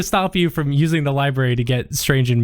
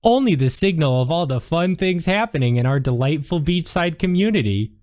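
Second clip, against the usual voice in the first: higher, sugary town-booster voice; sweet librarian, promotional; 5 semitones higher than usual. Acted. Librarian brushes off visitor's question with higher, sweet cheer. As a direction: deflect with a cheery sales pitch.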